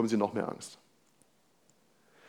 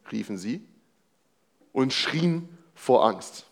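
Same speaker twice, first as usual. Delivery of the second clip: scripture reading; 2 semitones higher, 4 dB louder than usual. Acted. Reads the Bible passage aloud.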